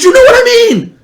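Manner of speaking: high, loud mock shriek; flustered fan. Starstruck woman explaining herself to Survivor player, imitated fluster.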